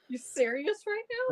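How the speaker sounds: high, incredulous mock question; dumbfounded disbelief. [name] reacting to Cody's news, high stunned disbelief. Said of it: "she's got that dumbfounded look like".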